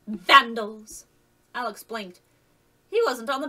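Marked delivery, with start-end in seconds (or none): 0.1-1.1 loud scolding cry; indignant shout. Acted. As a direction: scold the vandal as she passes. Woman scolding passerby.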